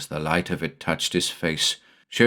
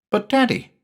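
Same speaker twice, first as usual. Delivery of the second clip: put-on high pleading boy's voice; whining child. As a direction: protest like a small boy. Jamie voiced in story.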